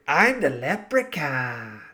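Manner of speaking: slow leprechaun voice; lightly voiced leprechaun. Shown as a demonstration. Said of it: "You can also say".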